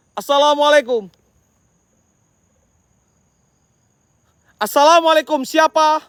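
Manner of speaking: greeting shouted across the graves; calling out. Real, not acted. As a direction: call the greeting out to the spirits. Calling out at cemetery.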